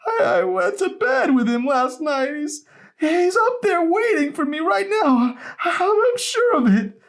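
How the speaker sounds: a grieving grandma clinging to denial; stammering grief. A narrator's reading. Grandma insisting Henry waits upstairs, high stammering grief. Grandma denies husband's death.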